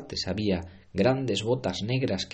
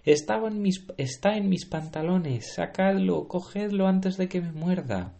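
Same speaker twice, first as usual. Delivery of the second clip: high frantic yell; panicked shouting; 10 semitones higher than usual. Acted. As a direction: scream it, terrified of a bite. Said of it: "el estúpido cocinero gritaba".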